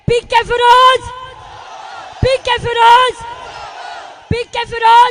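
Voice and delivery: loud, high rallying chant; slogan shout. Real, not acted. Speaker leading rally slogans for the candidate, loud chant. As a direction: shout the name to rouse a crowd.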